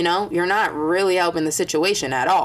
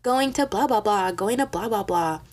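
higher, sing-song mock boast; mock bragging; 5 semitones higher than usual. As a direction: show off in an airy imitation. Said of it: "You wanna be like".

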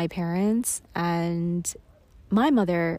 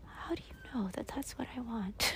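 whispered reply; 4 semitones higher, 12 dB quieter than usual. Retold or imitated.

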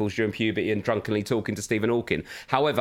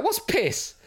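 put-on high child voice asking; squeaky little-girl question, much higher; imitating daughter; 13 semitones higher, much slower than usual. Father voices his daughter asking, high and curious. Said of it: "then she was like".

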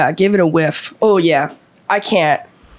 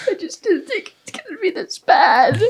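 laughing; 9 semitones higher, 3 dB quieter than usual. Real, not acted. Laughing while dreading the bite.

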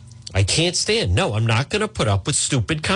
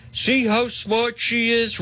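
mock admirer; 8 semitones higher, slower than usual. Mocking her defenders.

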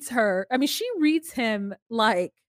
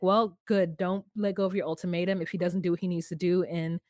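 quiet advice; 6 semitones lower, 4 dB quieter than usual. Retold or imitated. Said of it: "she's like".

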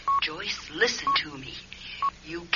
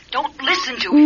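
louder, insistent order; commanding; 4 semitones higher, 8 dB louder than usual. Acted. Sheila's ghost loudly ordering Joyce to ignore Gordon. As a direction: cut through her thoughts with a command.

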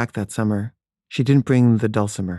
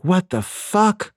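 stunned disbelief; 8 semitones higher, much slower than usual. Retold or imitated. She's stunned after being hit.

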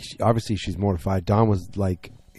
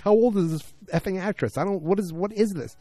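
high, incredulous squeal of shock; shocked disbelief; 11 semitones higher than usual. Retold or imitated. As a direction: react like you can't believe what you see, pitched way up. Shocked viewer reacting to scene.

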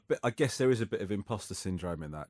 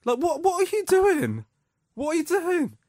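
shocked dad confronting a rude stranger, pitch way up; incredulous outburst; 7 dB louder than usual. Retold or imitated. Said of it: "I was like".

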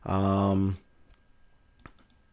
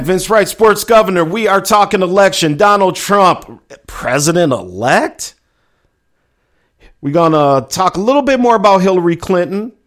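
higher, louder promo voice selling the show; radio announcer hype; 7 semitones higher, 5 dB louder than usual. Acted. Host announcing himself and the topics before the break, hyped announcer voice. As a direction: pump it up like a station ID before the break.

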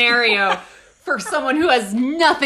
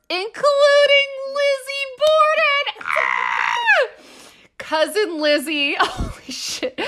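shocked; slower than usual. Real, not acted. Shocked reading the family link.

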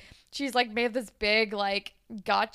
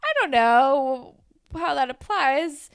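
flustered; 5 semitones higher, 4 dB louder, slower than usual. Retold or imitated. Caitlyn caught off guard on stage.

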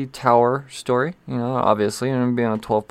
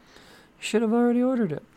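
much higher, put-on teasing voice; high mock voice; 12 semitones higher than usual. Teasing viewers who haven't ordered, high mock voice. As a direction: repeat it in a silly high voice.

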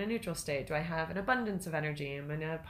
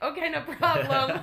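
much higher, louder, slow, through laughter; laughing mock agreement; 9 semitones higher, 8 dB louder than usual. Wife laughingly quotes husbands agreeing to everything, laughing. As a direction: crack up while quoting their easy yes. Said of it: "you guys are both like".

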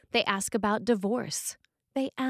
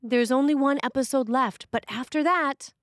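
higher, louder wheedling excuse; bingeing excuse; 6 semitones higher, 5 dB louder than usual. Retold or imitated. Someone glued to the TV, stalling a chore, hammed-up pleading. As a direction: plead for just one more episode.

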